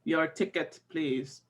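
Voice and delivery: low, soft, curt ticket request; cold German clerk. Retold or imitated. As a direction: clip it like an unfriendly clerk. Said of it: "cold demeanor where she was just like".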